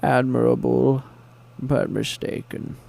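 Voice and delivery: slow, put-on villain voice; slow, deliberate mock villain; Bane impression. Villain tells underling who is in charge, slow mock menace. Playing Bane, movie lines.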